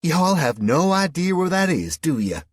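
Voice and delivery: mock him in a broad Southern twang; Southern woman teasing the Englishman. A narrator's reading. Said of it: "she asked mockingly".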